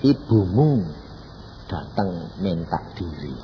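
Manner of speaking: slow, measured voice of God, lightly acted; suara Tuhan. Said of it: "Aku bilang, "Tuhan, siapa Tuhan?". (suara Tuhan yang pelan dan tenang)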